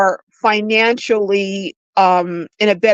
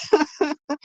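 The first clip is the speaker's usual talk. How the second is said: short laugh; 5 semitones higher, 7 dB quieter than usual. Real, not acted. Laughs at own twenty-years joke.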